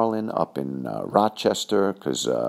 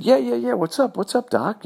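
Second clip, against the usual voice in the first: much higher and louder, tossed-off and cocky; hammed-up breezy what's up; overeager casual reply; 4 dB louder than usual. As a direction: play it too cool but overexcited. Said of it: "so I said".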